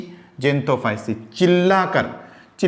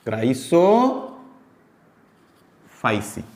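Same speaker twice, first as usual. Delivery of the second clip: very slow, क्राइसोफाइसी stretched syllable by syllable; slow dictation of the word; drawn-out syllables; 3 semitones lower, much slower than usual. Shown as a demonstration. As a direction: drag out क्राइसोफाइसी for students to copy. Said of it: "repeats term twice".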